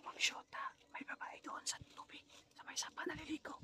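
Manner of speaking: urgent hushed whisper; whispering while hiding. Real, not acted. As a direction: whisper so they won't hear. Hiding after spotting someone.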